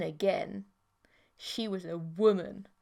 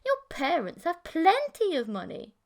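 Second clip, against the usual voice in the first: scoffing; 8 semitones higher, 5 dB louder than usual. Acted. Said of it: "she scoffed".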